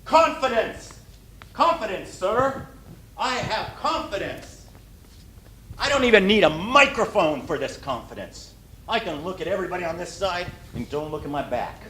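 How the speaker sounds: hammed-up boastful confidence; bold confident speaker. Shown as a demonstration. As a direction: show off the word Confidence boldly. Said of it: "He didn't say".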